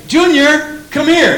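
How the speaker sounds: put-on loud, high call to a child. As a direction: call the kid across the yard. Parent calls child.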